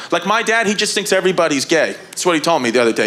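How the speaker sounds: normal talking voice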